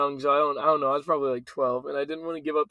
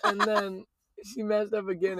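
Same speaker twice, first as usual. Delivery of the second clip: ordinary delivery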